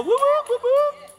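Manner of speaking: very high, loud party whoops; playful whooping. Real, not acted. Pastor cheering any Coke Zero drinkers, playful whooping.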